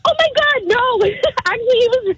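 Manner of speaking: high-pitched, giggly denial; real giggles breaking up the words; laughing protest. Real, not acted. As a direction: laugh through defending him. Laughs off host calling date loser.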